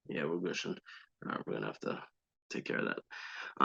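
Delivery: very low, hushed talking to yourself; quiet, trailing self-talk; distracted aside. Real, not acted. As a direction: drop your voice while checking a wound. Checks bleeding scab, aside to self.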